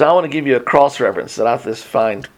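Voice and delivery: ordinary talk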